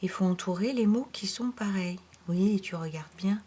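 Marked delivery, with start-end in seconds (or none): 2.3-3.4 whispered encouragement, no voice. Real, not acted. Teacher whispers encouragement to a pupil looking carefully. Coaching child to look carefully.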